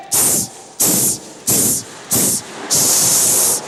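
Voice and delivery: a run of unvoiced snake hisses. Snake answers his fans with snake hisses. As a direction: hiss over and over like a snake. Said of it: "tout le monde le connaît".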